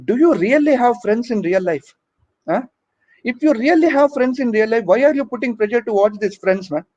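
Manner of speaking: ordinary delivery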